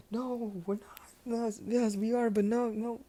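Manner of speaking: high, flustered denial that keeps flipping; freaking out. Acted. Midoriya flustered when his crush's father says they're dating, high and panicky. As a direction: squeak out a panicked yes-no. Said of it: "He's freaking out".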